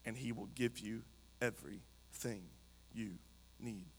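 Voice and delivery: hushed, measured Bible reading; scripture reading. Acted. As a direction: read the verse gravely, phrase by phrase. Reads scripture aloud.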